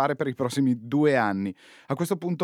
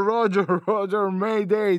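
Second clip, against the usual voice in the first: laughing radio pilot call; slow, high radio call; over a radio; 6 semitones higher, slower than usual. Pretend.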